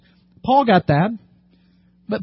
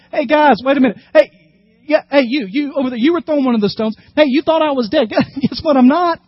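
loud, high hail to the crowd, with a laugh; calling out; 6 semitones higher, 4 dB louder than usual. Acted. Paul calling out to the stone throwers, loud and gleeful. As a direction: shout back at the men who stoned him.

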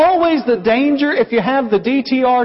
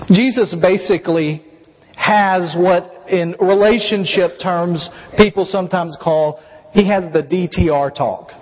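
normal talking voice

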